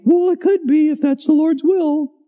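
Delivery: high falsetto reply; mock a sweetheart in falsetto; put-on high girlish voice; girlfriend's voice. Acting the woman's replies, falsetto.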